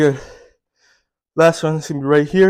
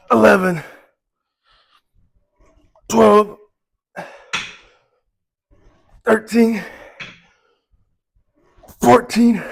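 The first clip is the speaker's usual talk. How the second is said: straining through reps; 6 semitones higher, 5 dB louder than usual. Real, not acted. Counting reps under heavy strain.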